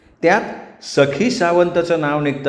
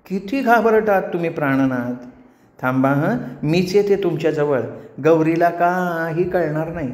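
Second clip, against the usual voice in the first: coaxing apsara; 3 semitones higher than usual. Acted. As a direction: coax the nervous man sweetly. Menaka soothes nervous Ganya. (लाडिकपणे समजावणारी Apsara)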